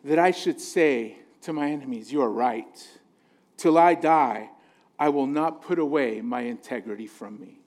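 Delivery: ordinary talk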